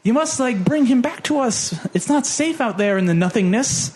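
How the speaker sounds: put-on high child's plea, cracking into a laugh; little kids begging their captain, high and laughing; kids' voice. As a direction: beg like a small kid, giggling at the end. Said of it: "the other kids are like".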